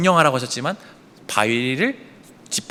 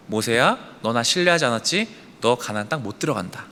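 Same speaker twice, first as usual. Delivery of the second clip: low, subdued pronouncement; quiet verdict; 6 semitones lower, 5 dB quieter than usual. Acted. God ending the matter with Moses, quiet and low. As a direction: state the judgement quietly and finally, lower.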